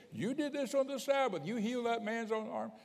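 high-pitched mock accuser voice; accusing Pharisees. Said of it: "Pharisees when they said".

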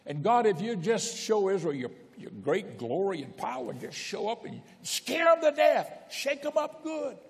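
high, straining plea that climbs to a peak; fervent pleading. Retold or imitated. Preacher voicing Elijah urging God, high fervent plea. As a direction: cry out for God to shake them up. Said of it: "That's what he wanted to tell God".

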